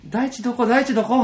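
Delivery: high, loud mock calling out; calling for someone across a room; excited calling. Imitating a relative bursting in calling out, high and loud. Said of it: "騒ぎ出して".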